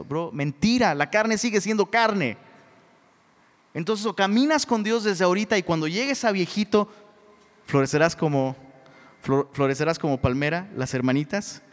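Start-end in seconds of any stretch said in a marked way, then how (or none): none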